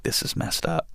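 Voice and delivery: breathless whisper to himself; a whispered inner thought after the crash. Retold or imitated. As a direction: whisper it like a private realization.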